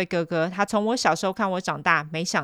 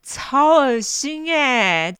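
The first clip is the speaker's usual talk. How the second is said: high, very slow revulsion; disgusted drawl; 7 semitones higher, 6 dB louder, much slower than usual. Real, not acted. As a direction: drag it out, grossed out. Disgusted reaction.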